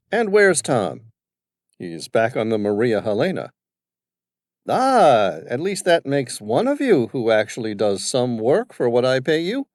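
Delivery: put-on high, loud, bossy old businessman; domineering father. Father questioning his son's crew, bossy and loud. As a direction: voice the overbearing father barking at his staff.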